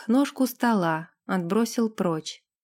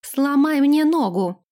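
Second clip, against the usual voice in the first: louder, higher insistence on "мне"; insistent demand; 5 semitones higher, 5 dB louder than usual. Acted. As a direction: push the order home. Repeated demand. (настойчиво, громче)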